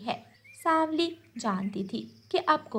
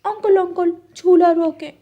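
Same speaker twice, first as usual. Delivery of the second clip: put-on high, louder child's call; 7 semitones higher, 7 dB louder than usual. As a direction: call the swing man to stop. Child calls swing owner.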